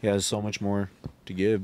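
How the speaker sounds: ordinary talk, nothing marked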